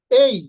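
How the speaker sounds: "এই" gliding from e to i; loud model of "এই" as a diphthong; correct letter name. Shown as a demonstration. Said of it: "আসল উচ্চারণ হচ্ছে". ("এই" শেষে ই টেনে)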